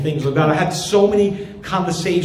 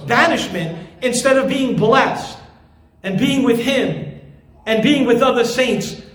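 full-force preaching; 5 semitones higher than usual. Acted. Pastor warns the congregation about rejecting Jesus, preaching at full force. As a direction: warn them with urgent force.

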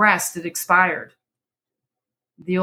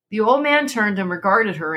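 usual speaking voice

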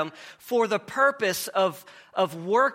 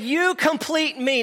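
higher, slow hammed-up film line; lightly hammed movie quote; mock Tom Cruise; 8 semitones higher, 3 dB louder than usual. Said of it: "he said".

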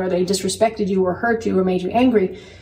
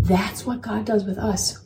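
ordinary delivery

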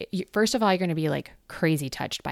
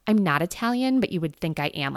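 usual speaking voice

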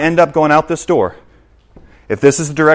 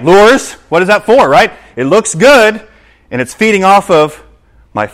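normal talking voice

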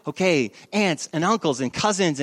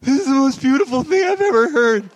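high, loud, overwhelmed gush; mock tearful awe; 8 semitones higher, 6 dB louder than usual. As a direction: gush as if about to cry, hammed up. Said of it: "I, like, wanted to start crying".

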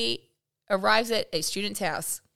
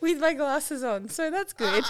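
higher, giggly real laughter; laughing; 5 semitones higher than usual. Real, not acted. Owning up after hitting the mic, laughing. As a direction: squeeze it out through giggles.

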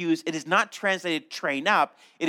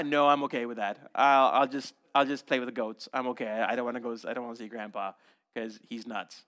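lower, softer and fading, hesitant refusal; reluctant grandchild; 7 semitones lower, 3 dB quieter than usual. Acted. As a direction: dodge the visit, voice shrinking away. Reluctant grandchild answering parent.

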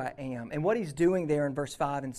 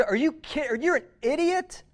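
loud and much higher, mock outraged scolding; angry scolding; 6 semitones higher, 3 dB louder than usual. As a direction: scold him as if he were a fool. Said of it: "by not getting angry".

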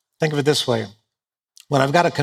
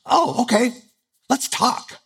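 higher, hammed-up eager reply; mock eager; 8 semitones higher, slower than usual. Sleepy husband mocking eager reply to wife, hammed-up. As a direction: pretend you're thrilled to talk. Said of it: "I'm not gonna say".

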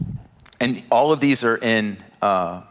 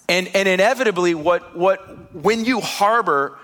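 preaching; 5 semitones higher than usual. Acted. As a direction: press the point home to the church. Preaching builds up.

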